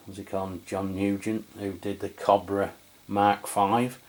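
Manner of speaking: usual speaking voice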